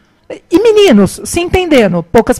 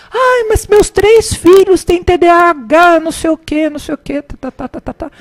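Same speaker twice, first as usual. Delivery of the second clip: high mock lament, tailing into rote; loud complaining mother; 7 semitones higher, 4 dB louder than usual. Mother going on about her three sons, high mock lament. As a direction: imitate a mother endlessly broadcasting her troubles. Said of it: "bem alto".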